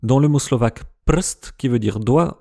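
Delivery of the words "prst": "prst" with trilled R as its vowel; no vowel in "prst", R carries the syllable; syllabic R. Shown as a demonstration. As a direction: roll the R through "prst". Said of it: "le R est une consonne syllabique".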